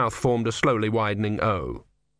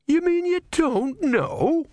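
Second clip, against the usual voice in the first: much higher, slow, aghast; can't believe the god doesn't know; shocked disbelief; slower than usual. A narrator's reading. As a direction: novice stunned by the tortoise. Novice shocked.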